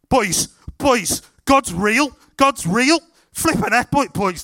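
loud, high-pitched amazed exclamations; excited shouting. Retold or imitated. Healed lad running round telling his mates, excited shouting. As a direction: imitate a lad yelling to his mates in disbelief.

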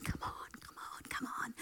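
hushed unvoiced come on; whispered urging. Teammates silently willing Downham on, whispered urging. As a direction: breathe it out like a tense whisper from behind. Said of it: "teammates behind her going".

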